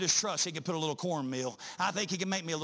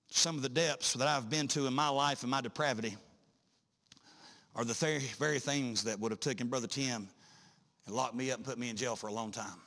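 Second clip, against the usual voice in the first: ordinary talk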